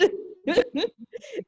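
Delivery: bright high burst of laughter. Real, not acted. Laughs after her point.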